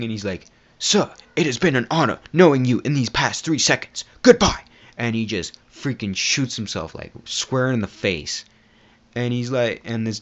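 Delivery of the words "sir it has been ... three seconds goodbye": high, loud mock-formal cultist voice; hammed-up farewell. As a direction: declaim a grand farewell, ending on a high loud cry. Acts cultist's farewell before shooting himself.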